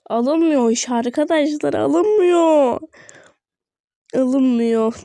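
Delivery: exasperated gamer whine; frustrated whine. Real, not acted. Frustrated item won't pick up.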